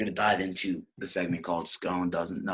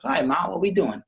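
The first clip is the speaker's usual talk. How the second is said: higher, louder son answering his mother; Nicky; 10 semitones higher, 5 dB louder than usual. Acted. Son asks his ma about dinner, acted son voice. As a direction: play an eager son, pitched up. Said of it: "He goes".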